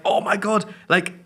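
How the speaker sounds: high amazed Oh my God; high shocked gasp. Retold or imitated. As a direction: gasp it out in disbelief. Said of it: "And I'm like".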